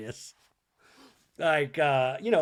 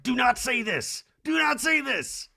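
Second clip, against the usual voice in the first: high, pleading mock producer; pretend producer; 9 semitones higher, 4 dB louder than usual. An imagined producer begging Erica to stop, high and drawn out. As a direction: beg her to stop, drawn out.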